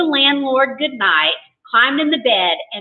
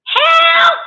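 very high, loud cry for help; terrified high-pitched scream of the word; shriek; 7 dB louder than usual. A narrator's reading. Terrified guest calls for help from the bed, shrieking. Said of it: "I shrieked".

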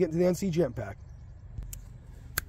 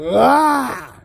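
disgusted cry; 8 semitones higher, 6 dB louder than usual. Real, not acted. Reacting to gross card.

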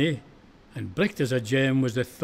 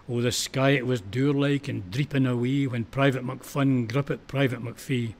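ordinary delivery, nothing marked

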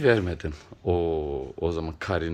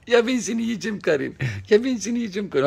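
much higher playful cooing voice; 12 semitones higher, 3 dB louder, faster than usual. Real, not acted. Baby-talk to pet.

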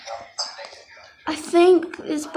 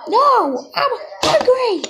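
defiant pup; 7 semitones higher, 3 dB louder than usual. Pretend.

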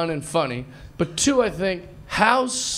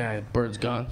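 much lower and softer sigh of disappointment; quiet resignation; 8 semitones lower, 9 dB quieter than usual. Retold or imitated. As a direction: let it drop, deflated and soft. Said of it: "So I was like".

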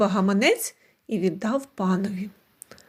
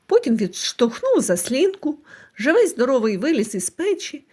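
plain reading voice

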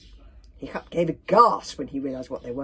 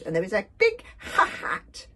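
louder, spluttering through food; mouth full; 3 semitones higher, 5 dB louder than usual. A narrator's reading. Dragon explaining the clue through cookies, mouth full. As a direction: stutter round a mouthful. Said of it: "spoke through a mouthful of cookies".

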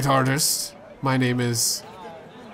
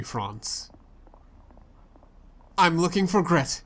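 ordinary delivery

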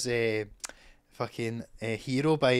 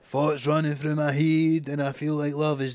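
put-on Scottish accent; 4 dB louder than usual. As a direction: croon it like a Scottish pub singer. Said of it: "but in a Scottish accent".

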